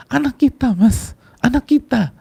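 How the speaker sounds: high, loud crying plea to the husband; sobbing high and loud, breaking down; tearful wail. Acted. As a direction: wail it like a mother who just lost her child. Wife sobbing out the news.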